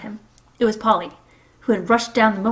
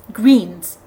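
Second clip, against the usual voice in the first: urgent call; 9 dB louder than usual. A narrator's reading. Polly urgent command.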